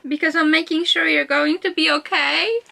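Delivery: high wail climbing to the end; distraught quote reading. Acted. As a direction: read it as if about to cry. Distraught at quote she reads out.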